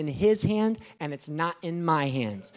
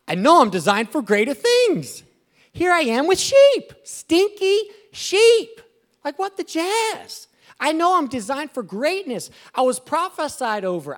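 put-on higher and louder, a shepherd boy griping about his lot; frustrated young David; 7 semitones higher, 4 dB louder than usual. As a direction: whine up high, fed up with stinky sheep. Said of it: "He's like".

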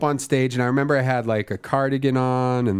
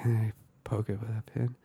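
mumbled answer; 10 dB quieter than usual. Retold or imitated. Terry answers meekly.

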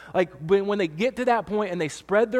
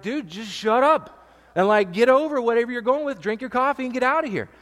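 higher, louder mock annoyed stranger; annoyed coffee shop customers; 6 semitones higher, 4 dB louder than usual. Pastor imitating annoyed customers, mock exasperated. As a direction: brush off the crybabies, exasperated. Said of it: "they're like".

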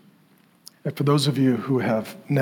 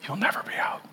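whispered promise; 6 semitones higher, 9 dB quieter than usual. Pretend. Father's closing promise to the child.

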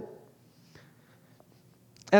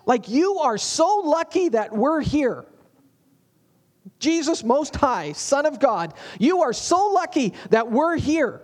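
higher mock-eager voice, leaning on so lucky; overeager Peter; 5 semitones higher than usual. Peter tells Jesus how lucky he is, higher and mock eager. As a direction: play Peter puffed up and pleased with himself. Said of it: "he goes".

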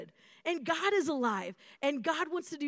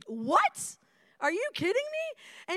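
surprised; 9 semitones higher, 4 dB louder, slower than usual. Retold or imitated. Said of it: "I would be like".

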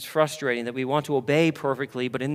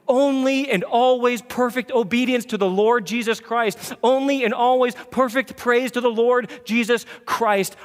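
higher, louder, soaring sermon climb; preaching at full force; 8 semitones higher, 6 dB louder than usual. Acted. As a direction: lift them toward the promise with a rising preach.

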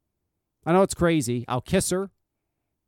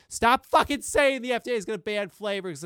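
exasperated outburst; 7 semitones higher than usual. Real, not acted. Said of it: "It gets me so upset".

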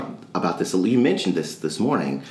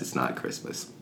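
soft, lower, breathy whisper under the breath; whispered aside; 7 semitones lower, 11 dB quieter than usual. Retold or imitated. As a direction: whisper it to yourself. Father answering his son.

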